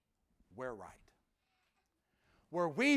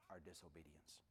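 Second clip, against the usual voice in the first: whisper; 28 dB quieter than usual. Real, not acted. Preacher whispering a confession to his congregation, hushed.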